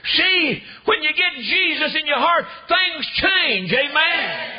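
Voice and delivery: high, loud revival shout; full-force preaching. Real, not acted. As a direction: call the congregation to say amen.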